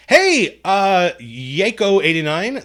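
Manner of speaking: loud, high, drawn-out hail; big high-pitched shout of hello; excited greeting. Real, not acted. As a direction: call out to a viewer, thrilled. Delighted call to a viewer.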